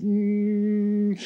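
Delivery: long, high, held "mm"; strained hum. Acted. Firewalker torn by a warning inner voice, tense hum. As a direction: hum as if knotted with tension.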